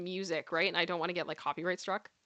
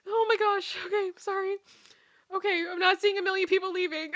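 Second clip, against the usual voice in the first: laughing; 10 semitones higher than usual. Real, not acted. Recovering from laughter.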